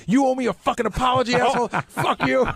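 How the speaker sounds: high mock angry yell; imitating a coworker yelling in an argument; retold angry yell. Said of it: "Sal starts yelling at me".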